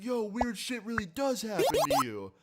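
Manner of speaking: high-pitched imitation of an amazed fan; excited fan. Fan realises who he has met, amazed imitation.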